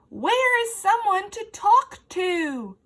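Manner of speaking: loud, high, slow booming question; booming wonder. Acted. Effie calls out from a grass stalk, booming. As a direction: boom out the lonely question. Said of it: "Her voice shook a web".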